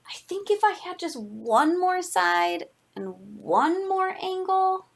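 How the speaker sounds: high, slow character voice; storybook shape asking hopefully; wishful pentagon. Acted. Pentagon pleads for another side.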